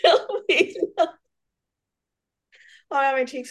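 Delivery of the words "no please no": laughing pleas. Real, not acted. Laughing fit while recording.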